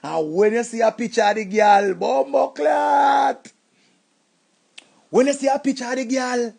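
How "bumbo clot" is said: drawn-out shocked curse. Acted. Man seeing his ex's new picture, shouting a slow curse. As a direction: stretch the curse out in disbelief.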